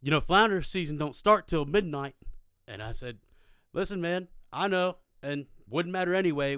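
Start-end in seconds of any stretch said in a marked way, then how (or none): 0.0-2.1 raised, higher-pitched projected voice; loud pointed reminder across water; officer calling over. Retold or imitated. Officer calling across between boats. 3.7-6.5 calling back. Retold or imitated. Teller answers officer across boats.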